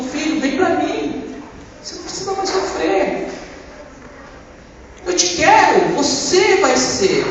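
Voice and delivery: high, slow and gentle, as God calling a son; tender voice of God. Retold or imitated. God speaking tenderly to him.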